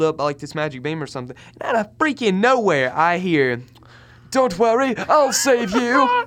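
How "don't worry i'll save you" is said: put-on high, loud, drawn-out British accent; hammed-up British hero, slow and high; British cat voice. Imitating the movie cat's rescue line, hammed-up British accent. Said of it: "British accent".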